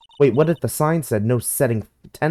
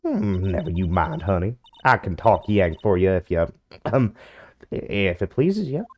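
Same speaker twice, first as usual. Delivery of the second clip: put-on Southern accent. Southern woman softening.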